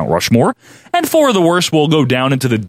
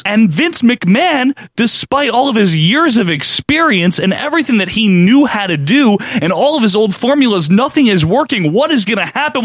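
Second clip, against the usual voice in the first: dramatic narration; 9 semitones higher than usual. Acted. Dramatic podcast outro narration.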